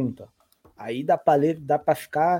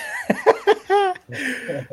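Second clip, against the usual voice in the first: burst of laughter. Real, not acted. Host laughs at being left nothing.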